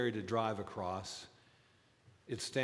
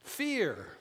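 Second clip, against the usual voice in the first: high, loud single word Fear; emphatic fear; 10 semitones higher, 4 dB louder than usual. Real, not acted. Pastor naming the fear on the bridge, emphatic. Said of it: "Justified fear".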